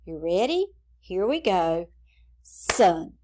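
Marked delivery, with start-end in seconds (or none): 2.4-3.1 "Sun" as one firm beat; one emphatic, isolated "Sun"; single clapped beat. Shown as a demonstration. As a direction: clap out "Sun" in a single syllable. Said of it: "let's clap out the syllables".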